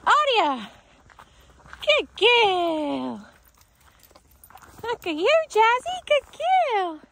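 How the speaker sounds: high, gushing, drawn-out praise; excited dog praise. Real, not acted. Trainer rewards dogs for returning, excited gushing. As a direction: make a big happy fuss.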